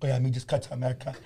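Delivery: put-on low, soft, quick Filipino accent; deadpan it quickly in a put-on Filipino accent; imitated Filipino newcomer, quiet and low; mock Filipino accent. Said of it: "And he went like this".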